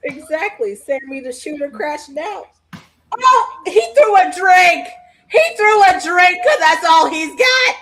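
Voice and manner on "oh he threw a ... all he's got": excited. Real, not acted. Reacting to wrestling.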